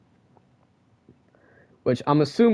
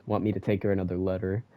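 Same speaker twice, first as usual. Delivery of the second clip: ordinary talk, nothing marked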